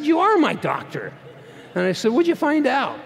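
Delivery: patient insisting to the doctor; impatient demand. Retold or imitated. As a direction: press the doctor for an answer.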